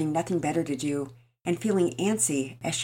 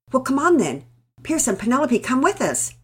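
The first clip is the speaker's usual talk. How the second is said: louder, brisk call to come; beckoning urgently; 8 semitones higher, 8 dB louder than usual. Acted. Father rushing the children out, brisk beckoning call. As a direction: hurry the kids along. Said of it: "Daddy Peters beckoned".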